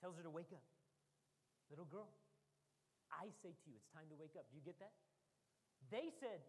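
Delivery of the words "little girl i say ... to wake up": soft, loving command; tender call. Acted. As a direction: lower and softer, gentle. Jesus wakes the girl.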